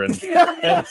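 ordinary talk, nothing marked

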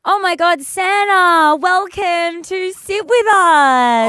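high, loud, drawn-out greeting; gushing welcome. Acted. Host greets Santa on the show, gushing excitedly. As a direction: squeal it like an overexcited host.

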